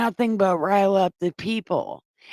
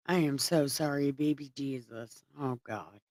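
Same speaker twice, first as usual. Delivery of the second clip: much softer and lower, sorry; quietly apologizing, hurt; hushed regretful murmur; real sorrowful apology; 7 semitones lower, 11 dB quieter than usual. Real, not acted. Upset reading the crucifixion.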